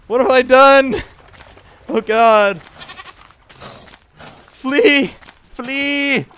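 very high, loud panicked yells; panicked shouting. Real, not acted. Riding a crocodile in panic, high frightened shouts. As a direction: scream to run away.

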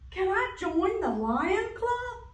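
high, eager little duck. Acted. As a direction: ask hopefully like a small duck. Duck character asks.

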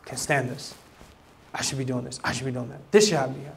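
hushed resentful grumbling of a churchgoer; inner grumbling. Retold or imitated. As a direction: grumble it like resentful private thoughts. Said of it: "But inside they're grumbling".

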